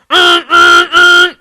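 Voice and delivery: high loud honking horns; blaring car horn imitation. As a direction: honk like speeding cars. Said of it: "went the horns on the speeding cars".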